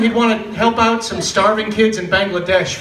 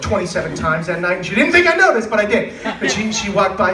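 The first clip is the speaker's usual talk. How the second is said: normal talking voice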